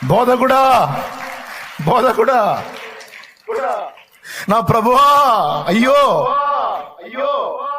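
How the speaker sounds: high, loud anguished wail; crying out. Acted. Mary cries out to Jesus, high and loud. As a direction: wail the call again and again. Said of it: "ఒక్క కేక వేసింది".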